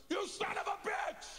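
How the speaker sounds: high, drawn-out furious shout; acted outburst of lost temper; road-rage yell. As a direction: yell it at the driver who cut you off. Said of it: "I lose my temper".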